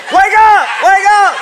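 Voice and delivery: high, loud frantic yell. Retold or imitated. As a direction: yell her awake, shaking her. Shaking sleepwalking wife, yelling.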